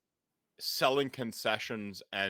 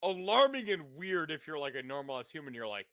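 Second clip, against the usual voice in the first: ordinary talk, nothing marked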